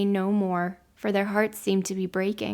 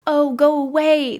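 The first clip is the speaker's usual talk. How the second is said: loud, higher, slow frightened cry; calling out; 6 semitones higher, 8 dB louder than usual. A narrator's reading. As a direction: shout at the door in terror. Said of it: "they called out".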